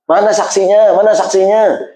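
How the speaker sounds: mild mock nagging wife; demanding wife. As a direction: press him for a witness.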